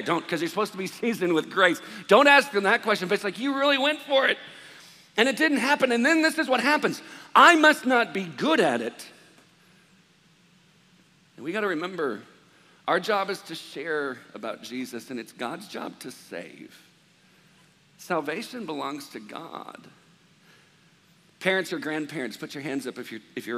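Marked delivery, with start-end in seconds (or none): 2.1-9.1 high, loud, hammed-up disappointment; mock deflation. Pastor acting out a witness who feels he failed, loud and hammed-up. 12.9-19.9 slow, softer and earnest; solemn reminder. Real, not acted. Pastor reminding the congregation that salvation is God's, slow and soft. Solemn key point of sermon.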